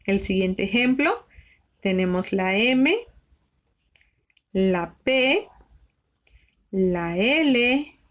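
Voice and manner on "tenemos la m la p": ordinary talk, nothing marked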